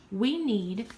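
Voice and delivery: normal talking voice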